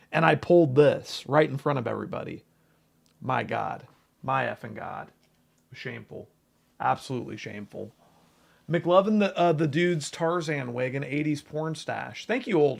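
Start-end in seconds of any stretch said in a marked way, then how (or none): 3.2-7.9 mock shame. Real, not acted. Mock self-scolding over lucky pull.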